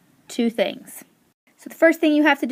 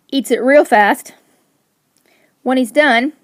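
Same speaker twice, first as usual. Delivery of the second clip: usual speaking voice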